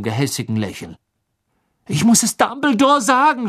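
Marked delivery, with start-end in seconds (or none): 1.9-3.5 high, strained desperate shout; desperate yell. Acted. Harry desperate to get past Snape, yelling. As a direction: cry out in panic, pitched way up. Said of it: "schrie Harry".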